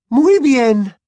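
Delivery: high, loud, cracked concession; voice breaking. A narrator's reading. Mother giving in to the others, voice breaking. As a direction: give in with your voice breaking. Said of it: "con la voz quebrada".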